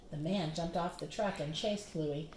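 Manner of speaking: ordinary delivery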